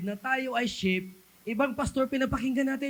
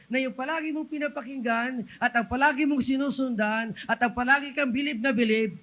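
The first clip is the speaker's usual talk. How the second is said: normal talking voice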